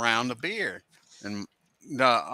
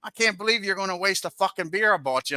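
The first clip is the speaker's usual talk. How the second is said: higher, mock-offended brother; retold outrage; 7 semitones higher than usual. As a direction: huff about the wasted beer, half-joking. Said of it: "And he's like".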